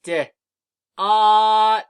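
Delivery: D then ot, each sound held long and loud; D, then ot, sounded out slowly and loudly; sounding out words. Shown as a demonstration. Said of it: "Let me do it again for you".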